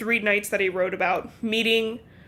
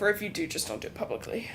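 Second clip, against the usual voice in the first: conspiratorial whisper, a bit faster; 8 dB quieter, faster than usual. Real, not acted. Joking advice to a cheating husband, whispered conspiratorially. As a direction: lean in and whisper the sneaky part.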